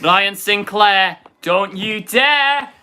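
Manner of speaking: loud, slow Yorkshire shout; shouted Sheffield accent. Retold or imitated. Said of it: "Sheffield accents are phenomenal".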